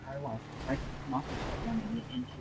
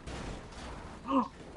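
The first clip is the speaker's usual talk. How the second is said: sharp gasp; 12 semitones higher, 9 dB quieter than usual. Real, not acted. Sudden crash in game.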